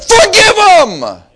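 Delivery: slow, high shouted command; full-force preacher's order; angry-sounding preaching. Real, not acted. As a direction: shout it out, stretching each word. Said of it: "I sound like I'm angry".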